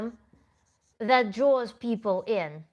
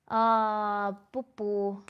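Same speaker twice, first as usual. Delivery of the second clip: ordinary delivery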